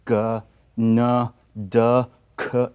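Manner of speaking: Ga, na, da, ka hit hard and loud; mock overstressed Korean syllables; exaggerated consonants. Shown as a demonstration. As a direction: overdo each consonant on purpose. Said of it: "too much exaggerated sound".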